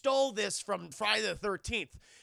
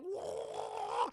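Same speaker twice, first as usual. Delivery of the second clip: long, very high mock "whoa"; mock wail; 13 semitones higher than usual. Victim pushed into boiling water, mock wail. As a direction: imitate a high wail of distress.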